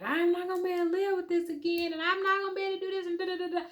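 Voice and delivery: high, fast mock wail; imitated boo-hooing. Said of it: "I'm crying and I'm boo-hooing".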